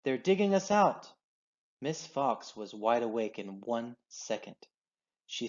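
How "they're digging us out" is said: higher, urgent warning; urgent cry. A narrator's reading. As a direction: raise the alarm. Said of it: "he shouted".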